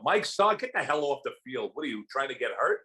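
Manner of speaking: high-pitched mock holler; retold yell. Director yelling at actor to get off the field, retold yell. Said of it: "Barry Levinson yells out".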